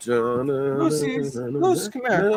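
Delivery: high-pitched pet-calling voice; calling a dog. Real, not acted. Owner calling Lucy to come up, high pet-calling voice.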